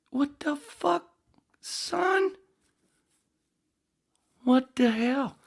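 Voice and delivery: very high, loud squawk of disbelief; baffled high-pitched yelling; incredulous outburst. Real, not acted. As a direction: protest in a shrieky high pitch. Discovers the story clip was short.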